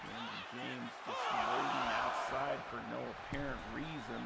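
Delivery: usual speaking voice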